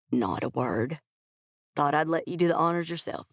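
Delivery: easygoing Texan boss ready to start; Texas twang. A narrator's reading. CEO leading the consultant to the meeting, Texas twang. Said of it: "his gentlemanly Texas twang".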